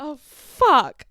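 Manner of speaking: loud, blurted curse; dismayed swearing. Retold or imitated. Realising her date likes another girl, loud dismayed blurt.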